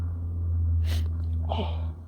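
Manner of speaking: deep whispered exhale; long heavy sigh. Real, not acted. Reader to viewers, deep tired sigh. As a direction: let out a long weary breath.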